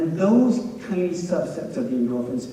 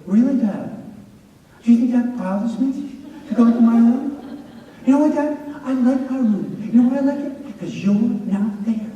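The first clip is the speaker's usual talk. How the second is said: higher, louder mocking teen comeback; sarcastic teen; 7 semitones higher, 3 dB louder than usual. Twelve-year-old son taunting dad, higher sarcastic comeback. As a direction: needle dad with smug teenage sarcasm. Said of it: "He's like".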